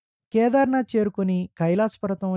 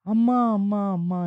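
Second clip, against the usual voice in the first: mild pained cry; 4 semitones higher than usual. A narrator's reading. Old companion struck by sudden pain, mildly crying out. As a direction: narrate a restrained cry of unbearable pain. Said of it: "నొప్పిని భరించలేక అలా అరుస్తూనే ఉన్నాడు".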